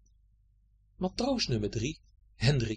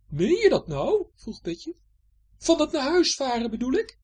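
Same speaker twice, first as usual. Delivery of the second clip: high, questioning voice; Betje; 12 semitones higher, 5 dB louder than usual. Acted. Betje checking Hendrik's promise, curious. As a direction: ask if he means it.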